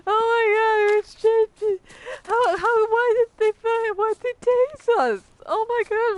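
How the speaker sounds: high, loud, whiny fake sobbing; mock crying. Mocking rioters whining about being tased, high shrill mock sobbing. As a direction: wail it like a crybaby mocking the protesters. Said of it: "like, crying".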